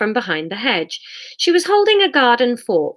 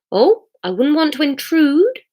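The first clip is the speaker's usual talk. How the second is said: Missus Shue voice; 7 semitones higher, 4 dB louder than usual. Acted.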